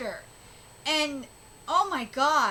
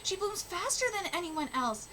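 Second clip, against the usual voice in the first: higher, earnest reading voice for the characters; reading manga aloud; 6 semitones higher than usual. Acted. Vlogger reading karuta manga dialogue to viewers, earnest and higher. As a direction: read each speech bubble with feeling.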